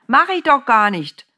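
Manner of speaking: high, loud protest; indignant cry. A narrator's reading. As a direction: shout back, stung. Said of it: "rief die Frau wieder".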